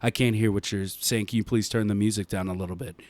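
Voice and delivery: low, soft, overly polite customer service voice; polite plea. Retold or imitated.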